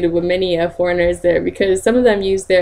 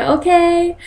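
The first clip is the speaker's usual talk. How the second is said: chirpy okay; 10 semitones higher than usual. Retold or imitated. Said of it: "And I was like".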